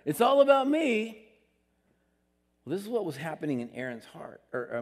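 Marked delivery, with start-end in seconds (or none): 0.0-1.2 louder, higher and slow, full of himself; hammed-up look-at-me voice; mock self-important. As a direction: show off like it's all about you. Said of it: "where it's like".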